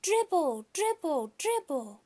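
high chanted shout fading down; children copying a silly word. A narrator's reading. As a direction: kids joining in a shout. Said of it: "they started to shout".

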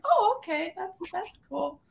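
put-on high, unimpressed child voice; imitating a kid. Kid reacting to the Stampede lesson, high and unimpressed. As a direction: shrug it off like a bored kid. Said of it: "you're kind of like".

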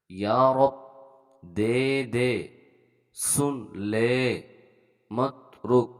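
word-by-word reading drill with held long vowels; pronunciation demo. Shown as a demonstration. Teacher showing Urdu learners the pronunciation, slow word-by-word demo. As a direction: read each word separately, stretching the final vowels. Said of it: "আগে উচ্চারণটা দেখব".

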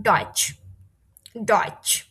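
"ডয়েচ" ending in a ch sound; tsch as ch. Shown as a demonstration. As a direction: model "ডয়েচ" as "doitch" for learners. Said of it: "এটা চয়ের মতো উচ্চারণ হবে".

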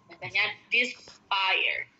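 "despire" slowly as "dispajer"; slow demo. Shown as a demonstration. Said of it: "Bacanya despire". ("despire" dibaca pelan "dispajer")

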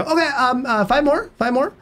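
put-on playful Spanish-accented English; bad Mexican accent. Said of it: "I've got a terrible accent".

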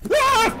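sharp high loud scream; startled scream. Real, not acted. Player jumps at the ghost, screaming. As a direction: shriek in fright. Jump scare.

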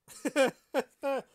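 high, loud cackle; burst of laughter. Real, not acted. Reader catches her mistake on the page count, loud laugh. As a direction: laugh at your own slip.

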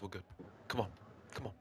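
ordinary delivery

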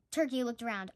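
usual speaking voice

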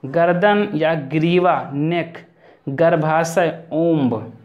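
ordinary delivery

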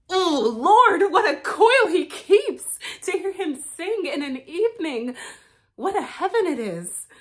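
high, breathless with laughter; giddy laughing swoon. Acted. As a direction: swoon giggling over his singing. Swooning over his singing.

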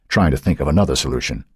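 plain reading voice

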